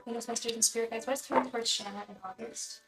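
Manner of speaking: soft, chant-like repeated invocation; quiet ritual chant of a tarot reader. Acted. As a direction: intone the question to the spirit guides.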